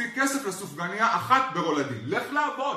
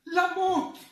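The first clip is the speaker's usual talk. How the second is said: mock high echo; 9 semitones higher, much slower than usual. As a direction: echo the word much higher in a put-on voice. Roast bit, echoed mockingly high.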